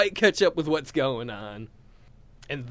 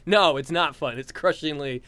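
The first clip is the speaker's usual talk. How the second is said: ordinary talk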